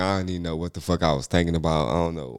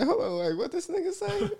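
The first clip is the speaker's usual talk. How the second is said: very high, baffled question; 5 dB quieter than usual. Retold or imitated. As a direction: ask it in pure disbelief.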